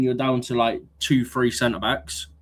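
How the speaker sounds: usual speaking voice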